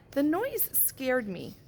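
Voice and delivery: plain reading voice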